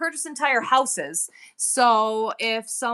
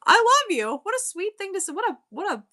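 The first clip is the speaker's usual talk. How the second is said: touched; 10 semitones higher than usual. Real, not acted. Touched by a viewer's compliment.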